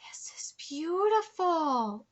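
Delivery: gasp, then slow hushed whisper; softly breathed-out wonder; awed whisper. Real, not acted. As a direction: whisper it slowly, overcome with awe. Awed reaction to story's revival scene.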